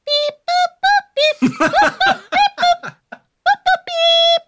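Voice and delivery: high squeaky beeps; koala beeping. Retold or imitated. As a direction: chirp the beeps like a tiny koala. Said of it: "the koala's going".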